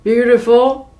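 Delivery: a loud puzzled question; baffled by the strange word; giants exclaiming. Acted. Giants hearing the word beautiful, loud puzzled exclaim. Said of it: "the giants exclaimed".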